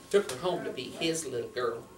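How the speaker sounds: plain reading voice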